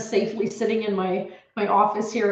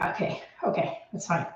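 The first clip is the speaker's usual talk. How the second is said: hushed nervous okay; 3 semitones lower, 7 dB quieter than usual. Retold or imitated. Panicked self-quote, store staff.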